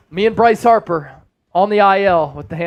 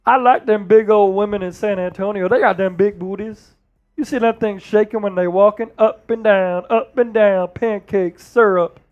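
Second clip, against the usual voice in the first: Charles Barkley impression. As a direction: ramble like Chuck on the TV desk. Barkley voice again.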